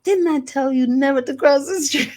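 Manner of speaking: put-on high, loud scolding child voice; a small girl telling grandma off; mock stern child; scolding little girl. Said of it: "And I was like".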